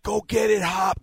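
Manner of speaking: high-pitched shout urging him on; retold shout. Player on sideline yelling to his receiver, shouting. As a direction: yell it across the field as the ball goes up. Yelling to teammate during catch.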